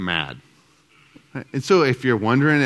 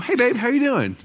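much higher, bright affectionate hello; sweet greeting; 14 semitones higher than usual. Retold or imitated. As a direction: greet him lovingly. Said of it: "Totally different".

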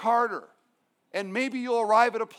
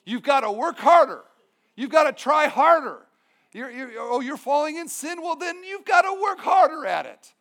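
rising to a loud hammed-up shout; mock legalist push; 4 semitones higher, 6 dB louder than usual. Legalist preacher urging sinners, building to a shout. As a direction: bark it like a pushy moralist, building. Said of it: "everything is about you doing your very best".

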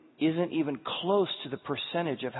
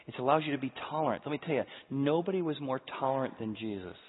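normal talking voice

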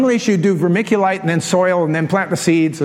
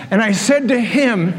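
ordinary delivery

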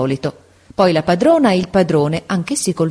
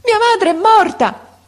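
very high, loud, drawn-out wail; anguished child's scream of grief; desperate cry; 5 dB louder than usual. A narrator's reading. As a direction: cry out in despair, certain of the worst. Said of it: "rispose con un altro grido disperato".

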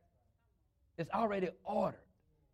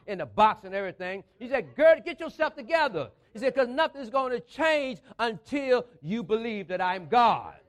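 forceful preaching; 6 dB louder than usual. Real, not acted. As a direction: punch out key words loudly.

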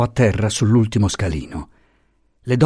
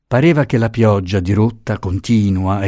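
ordinary delivery, nothing marked

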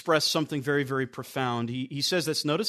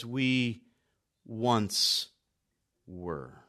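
much softer, slow, lower, "We once were" dropping away; hushed, weighty; 7 semitones lower, 7 dB quieter, much slower than usual. Shown as a demonstration. Preacher quoting Paul's powerful words, hushed and slow. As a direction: let each word fall quietly, pausing between. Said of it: "these powerful words".

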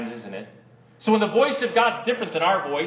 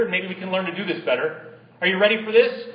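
usual speaking voice

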